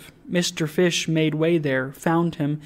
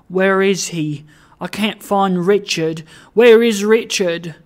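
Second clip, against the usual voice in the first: mildly distressed call, building louder; distressed searching; 4 semitones higher, 7 dB louder than usual. A narrator's reading. Trotty searches the company for Richard, distressed. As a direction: call out for a missing guide, growing more urgent. Lost Trotty seeks his guide.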